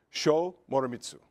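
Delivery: ordinary talk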